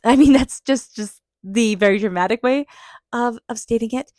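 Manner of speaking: loud, high laugh breaking into the words; amused at her own drama, laughing through it; laughing at herself. Real, not acted.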